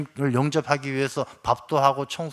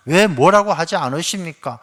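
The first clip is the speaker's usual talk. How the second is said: indignant complaint; 3 semitones higher, 8 dB louder than usual. Acted. Martha complains to Jesus.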